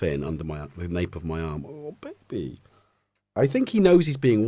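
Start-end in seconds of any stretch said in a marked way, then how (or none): 1.6-2.6 cooing at pet. Real, not acted. Cooing over the cat.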